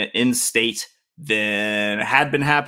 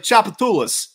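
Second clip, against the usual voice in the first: high-pitched New Orleans local accent; 9 semitones higher, 4 dB louder than usual. Shown as a demonstration. Showing a fake Southerner how locals talk, New Orleans accent. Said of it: "That's how they talk".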